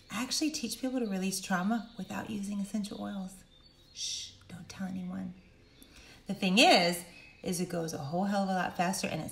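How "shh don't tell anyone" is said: hushed, whispered aside; playful confidential whisper; mock secret. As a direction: shush them, then whisper it like a secret.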